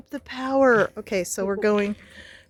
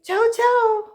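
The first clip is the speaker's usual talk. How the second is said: high, loud cheery goodbye; sing-song ciao; 14 semitones higher, 5 dB louder than usual. Real, not acted.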